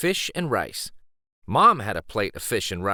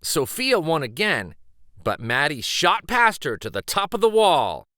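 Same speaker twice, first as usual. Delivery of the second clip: plain reading voice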